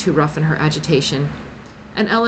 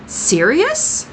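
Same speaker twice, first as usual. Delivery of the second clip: rising, puzzled echo of "Sirius?"; disbelieving question; puzzled incredulous; 11 semitones higher than usual. A narrator's reading. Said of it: "puzzled and incredulous".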